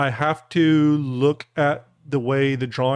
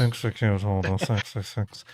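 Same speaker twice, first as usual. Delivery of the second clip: low film-villain echo of the order; lightly voiced villain; 4 semitones lower, 4 dB quieter than usual. Host repeating the sixty-six film order, lightly villainous. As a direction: drop low for a villain's command.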